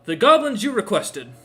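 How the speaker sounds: high, loud guard yelling his report; yelled announcement. A narrator's reading. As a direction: shout proudly right into the ruler's ear. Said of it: "he yells right in Daka's ear".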